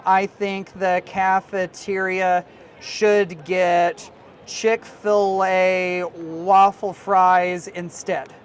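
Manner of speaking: very slow, word by word, as if typing "Chick-fil-A waffle fries"; dictating "waffle fries" slowly to the AI; slow dictation. Shown as a demonstration. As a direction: spell out the request slowly for the chatbot.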